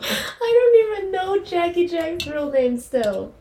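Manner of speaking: high, slow, laughing through the words; laughing admission. Real, not acted. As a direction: get it out while cracking up. Laughing about the real name.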